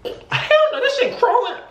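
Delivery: squealing hell no; horrified outburst. Real, not acted. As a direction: recoil in disgust while laughing. Imagining the roach.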